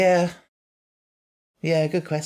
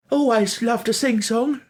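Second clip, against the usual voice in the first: put-on Italian accent; 10 semitones higher, 4 dB louder than usual. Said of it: "he goes".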